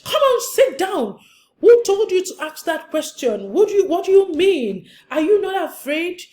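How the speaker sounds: loud, high-pitched angry telling-off; scolding parent. Acted. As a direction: shout down a child who dared to question. Acting a scolding, shouting parent.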